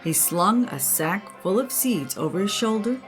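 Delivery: ordinary delivery, nothing marked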